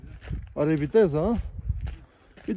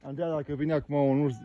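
ordinary talk

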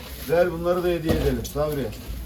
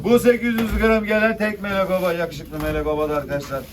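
loud auction call; auctioneer; 2 semitones higher, 4 dB louder than usual. Real, not acted. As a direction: call the lot to the room. Calling rising bids.

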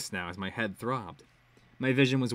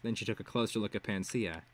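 plain reading voice

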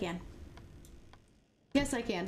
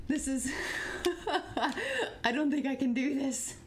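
doubtful words broken by giggles; scared, giggly admission of defeat; nervous laughing; 3 semitones higher than usual. Real, not acted. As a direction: laugh through the reluctance. Doubting self, laughing from fear.

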